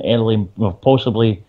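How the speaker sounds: normal talking voice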